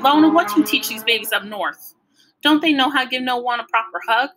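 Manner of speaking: fond telling-off from a grandmother; lightly voiced chiding grandma; scolding grandma. A narrator's reading. Grandma scolds her daughter while hugging.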